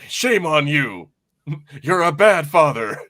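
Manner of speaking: put-on slow, scolding old man; mock stern lecture from an old man; lightly voiced scolding old man; lecturing old man. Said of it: "the old man just lecturing".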